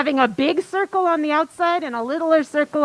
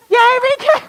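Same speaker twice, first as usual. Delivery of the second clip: high, loud cheer; real, delighted yelling; excited cheer; 11 semitones higher, 8 dB louder than usual. Real, not acted. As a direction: cheer a welcome at full pitch. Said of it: "I got excited".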